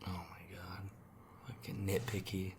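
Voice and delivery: exasperated under-breath mutter. Real, not acted. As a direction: mutter it to yourself, annoyed. Mutters at friend's correction.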